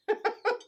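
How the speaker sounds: high amused laugh; bright laugh. Real, not acted. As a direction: laugh at your own joke. Laugh after joke.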